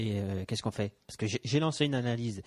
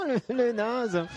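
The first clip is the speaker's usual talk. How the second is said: mocking; 11 semitones higher, 4 dB louder, much slower than usual. Mocking the captain.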